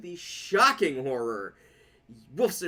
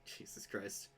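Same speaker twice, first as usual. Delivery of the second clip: whispered; 7 semitones lower, 17 dB quieter than usual. Real, not acted. Exasperated reaction, whispered.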